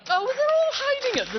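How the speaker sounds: loud, very high-pitched exclamation; high, loud mock-exasperated cry; calling out. Real, not acted. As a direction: call out high over the applause. Calling out to absent volunteers.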